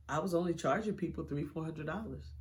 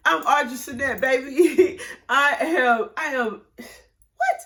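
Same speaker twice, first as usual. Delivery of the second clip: loud, high, proud laugh; 7 semitones higher, 10 dB louder, slower than usual. Real, not acted. Announcing her name to the interviewer, laughing proudly. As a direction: laugh it out triumphantly.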